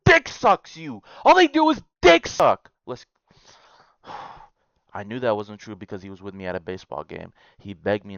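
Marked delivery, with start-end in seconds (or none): 0.0-2.7 outraged shout. Real, not acted. Ranting about Reddit commenters, high outraged yelling. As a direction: blow up at the whole site.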